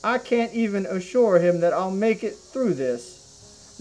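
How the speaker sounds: usual speaking voice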